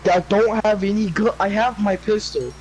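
ordinary delivery

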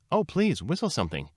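high, delighted plea; acted delight, leaping up in pitch. A narrator's reading. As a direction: beg eagerly, jumping high on the first words. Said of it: "Lily Bunny was delighted".

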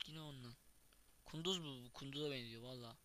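ordinary talk, nothing marked

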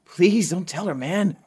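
high, loud urgent begging; anxious pleading. Acted. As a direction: beg him to keep it secret. Begs friend to keep secret.

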